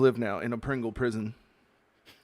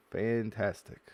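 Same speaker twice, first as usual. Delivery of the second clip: ordinary talk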